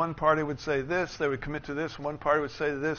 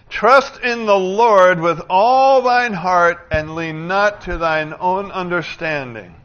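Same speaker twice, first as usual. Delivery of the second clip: very loud, slow, raised-pitch proclamation; forceful scripture reading; 4 semitones higher, 14 dB louder, slower than usual. Acted. Preacher reading that again to the congregation, thundering it. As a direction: thunder the verse with drawn-out words. Rereads verse at full force.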